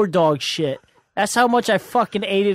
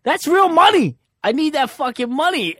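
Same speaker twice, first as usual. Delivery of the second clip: high, loud panicked shout; shouting about his held money; frantic yell; 11 semitones higher, 5 dB louder than usual. Retold or imitated. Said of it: "I was like".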